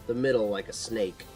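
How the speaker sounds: usual speaking voice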